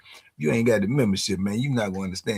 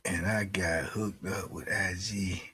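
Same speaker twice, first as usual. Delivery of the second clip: whispering; 4 semitones lower, 8 dB quieter than usual. Real, not acted. Confiding aside about IG.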